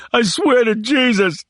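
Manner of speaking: high sobbing plea. Acted. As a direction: sob it out desperately. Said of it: "Lampert sobbed".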